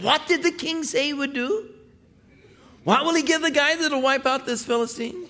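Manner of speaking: put-on very high, boyish eager questions; high boyish eagerness; eager young David.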